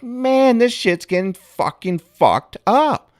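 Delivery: high, slow, worked-up griping; overexcited exasperation. Retold or imitated. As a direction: vent like you're wound up. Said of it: "I was like".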